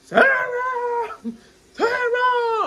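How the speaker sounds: high, loud repeated calling out; imitating a loud annoying call; mock yelling a name. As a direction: yell the name over and over. Imitating the yelled name again.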